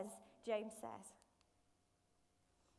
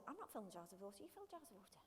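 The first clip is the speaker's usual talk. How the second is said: much softer, grumbling squabble; hushed bickering; 2 semitones lower, 13 dB quieter, much faster than usual. Acted. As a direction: pass the job on under your breath. Acted squabble among reluctant servants.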